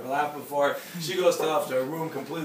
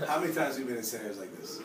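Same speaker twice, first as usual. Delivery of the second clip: normal talking voice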